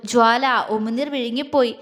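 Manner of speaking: plain reading voice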